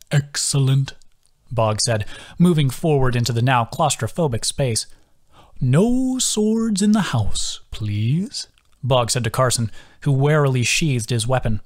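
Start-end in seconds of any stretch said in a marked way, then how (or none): 0.1-0.9 settled sing-song echo. A narrator's reading. Bog repeats it softer. 5.6-7.6 slow, higher, politely firm; a kindly host laying down a rule; slow polite creature request; gentle sing-song warning. A narrator's reading. Bog scolds gently in character. 7.7-8.5 soft unvoiced please; whispered polite plea. A narrator's reading. Whispered end of request.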